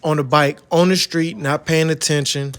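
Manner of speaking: loud show-intro voice, punching each phrase; hype intro. Acted. Host opening his bike-life episode, loud and punchy.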